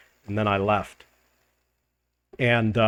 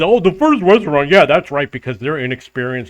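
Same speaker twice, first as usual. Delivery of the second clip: mocking; 2 semitones higher, 6 dB louder than usual. Mimicking the man who called him a liar, mocking.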